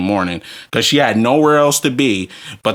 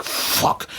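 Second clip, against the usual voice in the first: whispered curse; 7 dB quieter than usual. Retold or imitated. Muttering on seeing ex.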